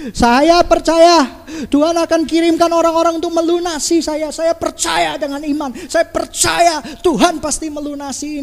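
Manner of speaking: high-pitched mock declaration of faith, loud at the start; hammed-up bold prayer claim; grand faith declaration. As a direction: proclaim it like a fervent, overblown believer. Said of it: "Hebat sekali bunyinya".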